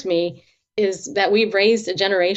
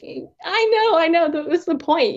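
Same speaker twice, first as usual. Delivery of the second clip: whiny concession; 8 semitones higher than usual. Retold or imitated. Answering God while walking.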